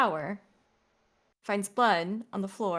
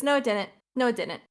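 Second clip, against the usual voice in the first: dismissive husband; 3 semitones higher, 5 dB louder than usual. Retold or imitated. Husband waving off his wife's fright, loud and dismissive. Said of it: "And he goes".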